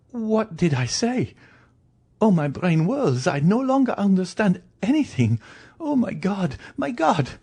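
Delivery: very high, frantic woman's voice; wailing, overwrought despair; distraught wife. Acted. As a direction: reel in high-pitched panic.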